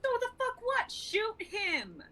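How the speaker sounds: very high, sharp yell; furious shout. Acted. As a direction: scream the order in disbelief. Luca yells back at Johan.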